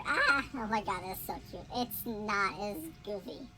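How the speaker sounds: ordinary delivery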